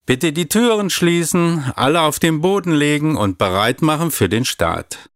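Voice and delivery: captain giving orders. A narrator's reading. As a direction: play a little girl commanding her crew. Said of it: "als Kapitänin das Kommando".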